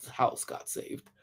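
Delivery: whispered, slow jab; petty whisper. Retold or imitated. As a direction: breathe out the petty comeback slowly. Petty retort told to member.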